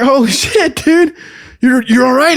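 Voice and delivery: loud, high-pitched retold amazement; amazed shout. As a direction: shout in disbelief, acting it out. Amazed at buddy waking up.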